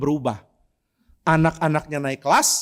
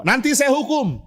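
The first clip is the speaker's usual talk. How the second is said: threatening teacher; 9 semitones higher than usual. Retold or imitated.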